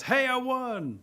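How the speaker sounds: high, pleased "I won" call; triumphant turtle. Acted. Turtle to Thunder at the pond, mildly triumphant. Turtle crows after winning race.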